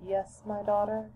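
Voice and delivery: high, soft motherly answer; soft gentle reply. Acted. Mother answers her daughter's call, soft and gentle. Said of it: "came the soft, gentle reply".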